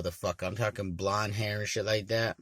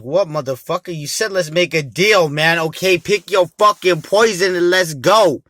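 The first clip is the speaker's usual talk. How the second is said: loud, higher, aggressive snap; loud and combative, higher pitched; angry confrontation; 8 semitones higher, 13 dB louder than usual. Retold or imitated. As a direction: get in his face, bigger and louder. Confronting the dealer.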